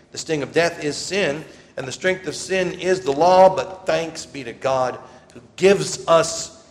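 loud, ringing scripture reading; preaching scripture. Acted. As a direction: proclaim it to the whole church. Proclaims scripture passage.

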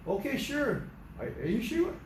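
high-pitched, hesitant surprise; acted amazement. Car owner answers boy's offer to wash car, acted amazement. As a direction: play someone taken aback by an offer.

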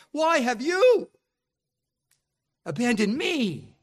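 preaching Christ's cry to the Father, rising to a loud high peak; building anguished plea. Acted. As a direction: build from pleading to near-crying out. Building impassioned voicing of Jesus.

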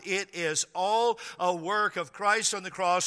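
ordinary talk

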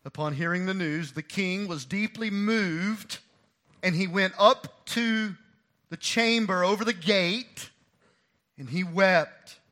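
building, slow preaching narration; rising sermon narration. Acted. Preacher building the scene of David's grief, slow and rising. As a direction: lift the story toward the king's weeping.

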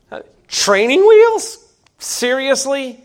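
high, slow, incredulous rising question; mock disbelief. Real, not acted. Preacher scoffing at training wheels to congregation, mock disbelief.

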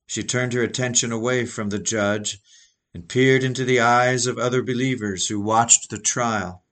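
normal talking voice

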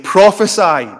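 loud, high mocking command; acted taunt, pitched high and falling. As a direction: jeer it out loudly as a taunt. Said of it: "they mocked him by saying".

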